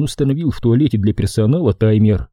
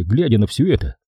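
ordinary delivery, nothing marked

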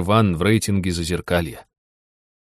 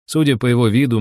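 usual speaking voice

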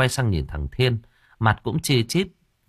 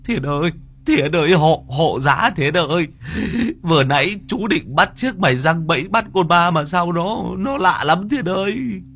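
put-on an old fraud bawling for his nephew; high-pitched panicky whimpering plea; wailing old man; 8 semitones higher than usual. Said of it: "Lão bù lu bù loa".

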